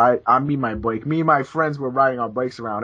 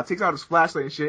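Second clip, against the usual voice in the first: ordinary delivery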